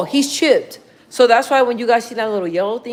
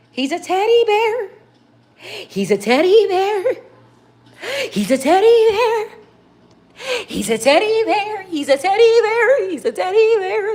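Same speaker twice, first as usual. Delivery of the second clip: high, sing-song mimic of a doting owner; mock the gushing owner, lines repeated over and over; 12 semitones higher than usual. Mocking the one who spoils the dog, high gushing mimic. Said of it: "It's been like this ever since you".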